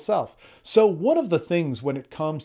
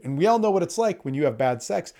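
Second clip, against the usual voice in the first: ordinary talk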